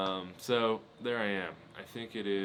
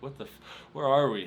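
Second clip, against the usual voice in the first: shocked; 5 semitones higher, 8 dB louder, faster than usual. Retold or imitated. Shocked at wrong beach.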